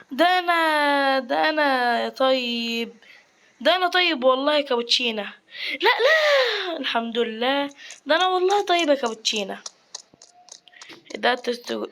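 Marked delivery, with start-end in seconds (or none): 5.8-6.8 sharp high cry of no, no; jolted yelp; alarmed no. Real, not acted. Gamer yelps as he almost dies, sharp and high. Sudden danger in game.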